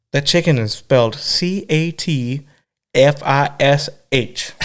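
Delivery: slow, deliberate spelling out; slow spelled reveal. Retold or imitated. Mom revealing the catfish to the kid, slow spelled-out letters. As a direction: spell it slowly for the big reveal.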